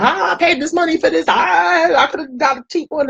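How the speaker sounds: high whiny complaint with a fake cry; mock upset customer. Imitating a viewer who is mad about torn pencils, in a high mock whine. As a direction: whine like a buyer who got cheated. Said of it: "you're gonna be mad at me talking about".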